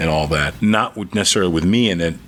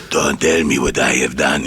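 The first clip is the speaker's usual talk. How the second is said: put-on low Greek-accented father scolding; deep Greek accent, indignant; dad's Greek accent; 7 semitones lower than usual. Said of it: "he has got the greatest accent".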